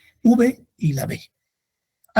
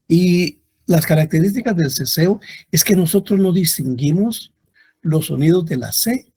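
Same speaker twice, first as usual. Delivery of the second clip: usual speaking voice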